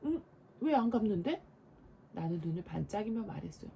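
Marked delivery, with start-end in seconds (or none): 0.0-1.4 mock stern question. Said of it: "눈을 부릅뜨고 물었어요".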